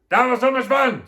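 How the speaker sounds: high, loud, cheerful exclamation; chorus of ladies. A narrator's reading. All the ladies after the party, high exclaiming. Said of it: "said all the ladies".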